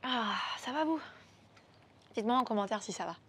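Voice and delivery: normal talking voice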